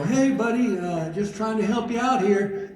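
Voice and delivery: high, placating voice; high-pitched friendly coaxing of an owl; coaxing a wild animal. Retold or imitated. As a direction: gingerly reassure a skittish creature. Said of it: "like, I'm a matador".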